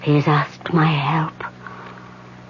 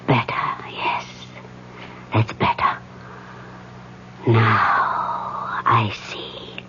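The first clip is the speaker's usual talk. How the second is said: slow whispered trance; 4 semitones lower, 6 dB quieter, slower than usual. Acted. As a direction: whisper as if seeing through a blinding light. Medium enters trance.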